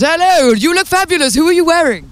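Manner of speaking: high, gushing red-carpet host; gushing red carpet chatter. Pretend. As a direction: gush over the outfit like a red-carpet host. Interviewer greets guest red-carpet style.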